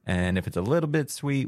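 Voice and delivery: usual speaking voice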